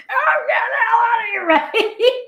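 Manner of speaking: high, loud, laughing patient's outburst; laughing mock shout. Retelling a patient's outburst to colleagues, laughing mock shout. Patient storming out against advice.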